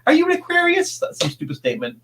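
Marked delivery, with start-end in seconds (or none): 0.1-1.0 high, silly parody of a cheesy horror movie line; mock movie line. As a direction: toss it off like a dumb movie cliché. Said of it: "And it's always, you know".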